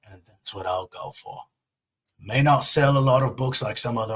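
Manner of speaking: put-on butcher an English accent, low and relaxed. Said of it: "I'll butcher it, but I'll try it".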